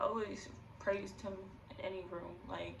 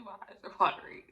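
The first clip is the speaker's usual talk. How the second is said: ordinary talk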